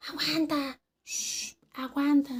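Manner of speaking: urgent "wait" broken by a shush; imitated hushing. Coworker telling her to wait, imitated hushing. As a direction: hold someone off with a shush. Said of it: "me hacía así".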